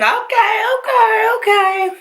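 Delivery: "okay, okay, okay" jumps way up high, nervous; high-pitched nervous "okay" repeated; squeaky okay. Real, not acted. Guard pulls out another foundation.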